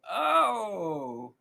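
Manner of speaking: shivering high oh; cold shiver sound. Real, not acted.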